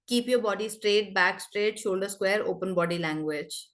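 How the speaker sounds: flat, low monotone reading. Shown as a demonstration. Trainer showing the class how not to read, flat monotone. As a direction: read it dull and level, no sing-song. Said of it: "I don't read it like".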